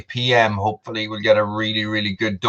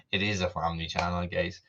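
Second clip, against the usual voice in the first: quiet aside; 8 semitones lower, 11 dB quieter than usual. Real, not acted. Aside about keeping it clean.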